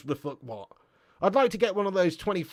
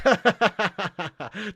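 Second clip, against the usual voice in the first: burst of laughter; 4 semitones higher, 6 dB louder than usual. Real, not acted. Laughs at chat insult.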